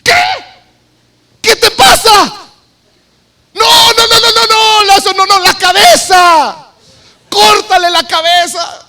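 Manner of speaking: high, frantic shouting from the back; horrified comrades urging him on at full voice; outraged onlookers. Acted. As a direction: yell in disbelief, stretching the final words. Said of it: "imaginen los que están acá atrás".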